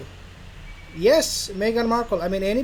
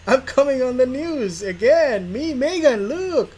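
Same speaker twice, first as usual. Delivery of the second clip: higher, louder excited Harry voice; mock Harry gloating; 7 semitones higher, 6 dB louder than usual. Harry showing Meghan he is on the news, excited and higher. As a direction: ham up Harry, thrilled to be on the news.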